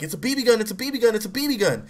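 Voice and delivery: high, rapid, insistent repetition; panicked pleading. Retold or imitated. Boy explaining to an angry cop, imitated panicked pleading. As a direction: plead your case in a mock panic.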